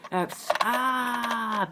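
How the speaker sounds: high, slow drawn-out pained cry; strangled cry. Acted. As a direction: let the overload tear out of you. Psychic overload, cry out.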